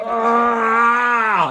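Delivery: loud high roar; wounded goblin roaring through the pain, loud and high. A narrator's reading. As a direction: bellow to overpower the pain. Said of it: "and roars so loud he overcomes that pain".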